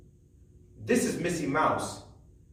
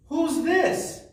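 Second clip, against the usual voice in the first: squeaky high guessing-game question; high quiz voice; 13 semitones higher than usual. Shown as a demonstration. Teacher quizzing children in a high playful voice. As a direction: ask the kids like a playful puppet.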